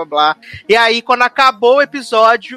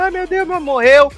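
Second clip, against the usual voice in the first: higher, mock sobbing wail; hammed-up grieving widow; mock crying; 7 semitones higher than usual. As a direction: fake a tearful cry, pitched up. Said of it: "Michonne chorando, falando".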